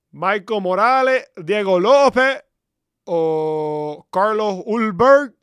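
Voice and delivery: loud ring-announcer hype; loud, drawn-out fight-night call; hype announce. Real, not acted. Hyping favourite fighters.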